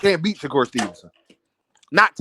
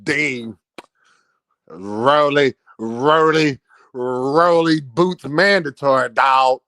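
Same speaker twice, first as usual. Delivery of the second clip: lightly mimicked Texas drawl on Dame; Texas accent; 6 semitones lower, slower than usual. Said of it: "in his Texas accent".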